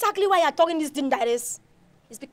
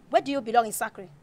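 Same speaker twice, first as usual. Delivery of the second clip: ordinary talk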